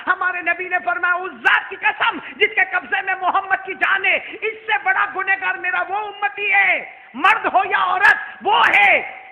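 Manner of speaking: high, shouted sermon climax; thunderous preacher's declaration; fiery preaching. Real, not acted. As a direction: proclaim the oath at full force, stretching key words. Preaches at full force.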